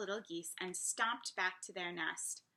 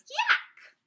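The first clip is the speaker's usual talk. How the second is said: very high, loud squawk of disgust; 12 dB louder than usual. A narrator's reading. Gosling fed by the bear, disgusted. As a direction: reject the food with a high yelp. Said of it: "they're not very appreciative".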